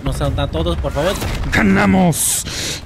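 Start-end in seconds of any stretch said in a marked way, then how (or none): 1.5-2.8 very slow, drawn-out ganamos; victory cry. Real, not acted. Gamer celebrating the win to his viewers, long drawn cheer.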